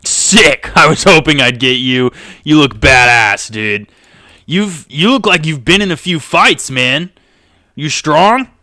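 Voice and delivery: loud, pumped-up admiration; brash Craig. Acted. Craig to his new battle partner, loud and impressed. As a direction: hype up a tough new partner. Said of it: "Craig looks at you all".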